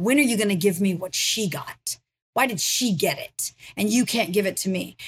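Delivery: ordinary delivery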